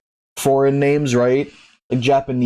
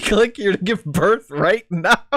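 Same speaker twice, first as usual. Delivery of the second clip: disgusted laughter; 9 semitones higher, slower than usual. Real, not acted. Reading absurd site, laughing.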